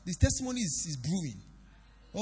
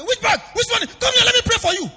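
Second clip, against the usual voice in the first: loud, high reply through laughter; laughing defiant shout at the robber; 14 dB louder than usual. Retold or imitated.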